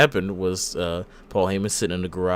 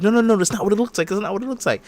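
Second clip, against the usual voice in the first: frantic pleading; 10 semitones higher, faster than usual. Retold or imitated. Heyman pleading with Roman.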